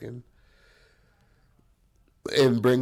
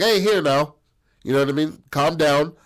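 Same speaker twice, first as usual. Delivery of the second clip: calling out; 5 semitones higher, 5 dB louder than usual. Retold or imitated. Ringleader calming a crowd.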